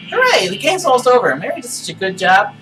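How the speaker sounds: high, cheery doll voice; squeaky doll, bubbling with joy; talking doll. Acted. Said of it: "Pull the string".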